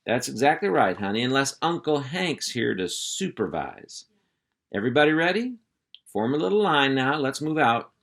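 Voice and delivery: hearty uncle voice. Acted. Uncle Hank calling the puppies to attention, hearty and loud. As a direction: round up the kids like a ranch boss.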